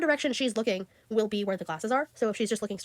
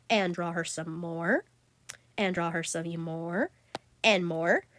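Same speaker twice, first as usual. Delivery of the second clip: slow, lower, dragging each word out; drawn-out repetition; 5 semitones lower, much slower than usual. Acted. To viewers, about redrawing her endlessly, slow and dragging. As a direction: sound like the drawing never ends.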